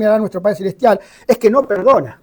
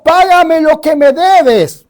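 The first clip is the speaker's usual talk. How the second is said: slow, louder threatening demand; harsh demand; 8 semitones higher, 6 dB louder, much slower than usual. Acted. Servant choking his fellow servant over a debt, harsh demand. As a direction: squeeze the words out like a choke. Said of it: "le ahogaba diciendo".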